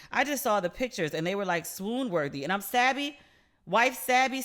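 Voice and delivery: usual speaking voice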